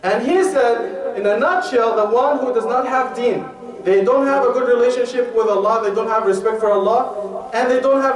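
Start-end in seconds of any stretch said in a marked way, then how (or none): none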